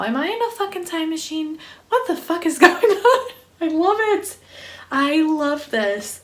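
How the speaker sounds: high, giddy squeal with laughter; giddy disbelief. Real, not acted. Reader amazed by the time loop, giddy high disbelief. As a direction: let amazement send the voice way up.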